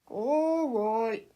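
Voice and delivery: reluctant, eye-rolling okay; smirking. A narrator's reading. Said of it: "rolling his eyes and smirking".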